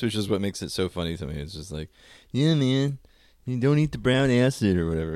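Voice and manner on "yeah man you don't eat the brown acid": higher, laid-back hippie. Retold or imitated. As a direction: do the classic stoner hippie. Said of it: "the stereotypical hippie voice".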